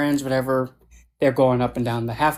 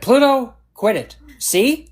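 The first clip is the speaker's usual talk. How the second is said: real scolding; 9 semitones higher, 3 dB louder, slower than usual. Real, not acted. Scolding pet live on stream.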